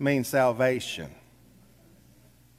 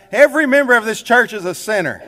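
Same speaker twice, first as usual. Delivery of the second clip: thundered out; 5 semitones higher, 6 dB louder than usual. Retold or imitated. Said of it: "he thundered out".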